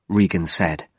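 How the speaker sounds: ordinary delivery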